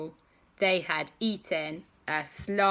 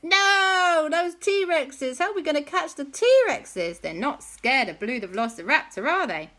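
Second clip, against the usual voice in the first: high, loud wailing worry; mock dismay; 10 semitones higher, 7 dB louder than usual. Everyone facing the uncatchable T-Rexes, mock dismay. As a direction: wail it in hammed-up panic.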